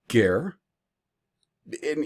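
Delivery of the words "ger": ger as one unbroken syllable; ger said continuously. Shown as a demonstration. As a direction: run ger together without a break. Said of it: "If we're just to pronounce it, uh, continuously".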